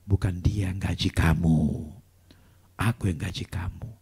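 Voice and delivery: low, slow, hushed voice; God's gentle reassurance. Retold or imitated. God's reassuring words acted out. (suara Tuhan, rendah dan lembut)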